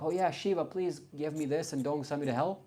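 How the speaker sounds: high, pleading prayer to Shiva, hammed up; mock pleading believer. Mocking a believer who prays to Shiva, in a high pleading voice. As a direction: beg the god like a frightened believer.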